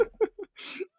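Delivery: squeaky high-pitched giggle; real amused giggle at the memory. Real, not acted. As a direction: let a thin high laugh slip out. Laughs after retold reaction.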